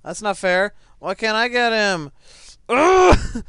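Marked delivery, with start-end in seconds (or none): 2.7-3.4 high, loud exasperated ugh; frustrated groan. Real, not acted. Player failing to catch someone, frustrated groan.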